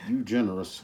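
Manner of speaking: ordinary talk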